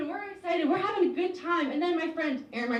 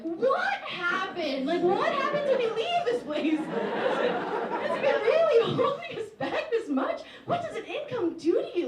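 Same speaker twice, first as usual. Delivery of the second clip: incredulous high squeal; 7 semitones higher, slower than usual. Retold or imitated. Stunned by her friend's splurge, high incredulous squeal. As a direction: shriek the questions in mock shock. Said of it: "I'm like".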